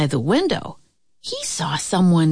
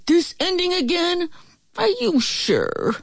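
high, mock shout without volume; wolf's shout; 11 semitones higher than usual. A narrator's reading. Wolf objects to the story's ending, restrained high shout. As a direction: protest high, as an exasperated wolf. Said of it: "Then he shouted".

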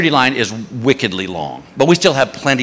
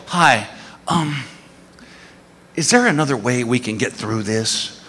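softer, hesitant asking; sheepish request; 3 semitones lower, 6 dB quieter than usual. Retold or imitated. Husband meekly asking the security guard for a way through, softer. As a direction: ask meekly, almost apologizing.

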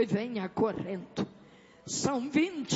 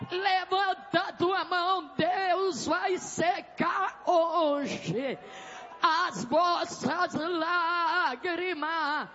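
fervent deliverance preaching; 6 semitones higher than usual. Real, not acted. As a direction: drive out evil like a revival preacher. Preaches and rebukes evil at full force. (pregação de libertação, ordens bradadas com fervor)

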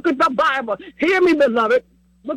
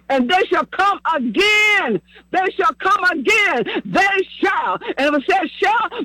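raised, slow proclamation of scripture; emphatic preaching; 7 semitones higher, slower than usual. Real, not acted. Preacher assuring parents their children shall come again, emphatic preaching. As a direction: proclaim each phrase like a promise. Preaches the verse with rising force.

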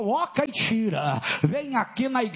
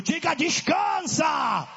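descansa called out high and strong; louder; 5 semitones higher than usual. Shown as a demonstration. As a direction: call out descansa with force. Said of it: "Não, tá fraco".

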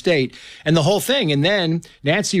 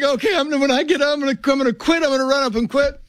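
put-on higher, jittery little boy psyching himself up; nervous kid; 10 semitones higher, faster than usual. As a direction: talk yourself into it like a scared kid. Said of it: "I'm like".

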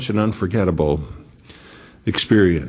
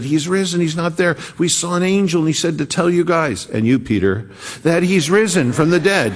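excited women; 8 semitones higher than usual. Retold or imitated.

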